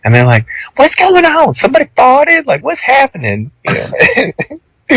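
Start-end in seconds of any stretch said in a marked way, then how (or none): 0.8-3.5 put-on high-pitched, bewildered girl voice; cafe girls. Cafe girls far behind asking what's happening, high and puzzled. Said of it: "even the girls in the cafe".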